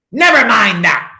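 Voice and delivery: loud, high curt rebuke; snapped. A narrator's reading. Miss Swamp silencing the kids' question, sharp loud snap. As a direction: cut the kids off sharply. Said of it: "snapped Miss Swamp".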